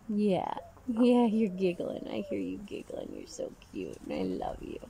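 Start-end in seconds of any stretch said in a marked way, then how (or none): 2.6-4.8 much lower, softer tender murmur; low soft pet talk. Real, not acted. Tender to the bird.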